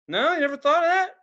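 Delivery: can't believe she never thought of it; incredulous. Retold or imitated. Asking the shop owner in disbelief, high and loud.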